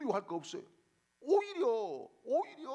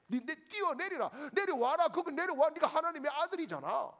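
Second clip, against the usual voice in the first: higher, louder jeering call up at the cross; mocking taunt; 7 semitones higher, 5 dB louder than usual. Pastor voices the mockers jeering at Jesus, loud and taunting. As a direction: goad him to come down, jeering. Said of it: "이렇게 부추기는 겁니다".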